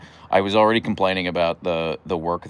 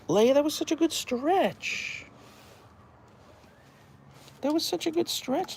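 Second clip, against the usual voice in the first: much higher, slow cooing voice. Real, not acted. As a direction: coo lazily at the stretching dog. Praising her dog.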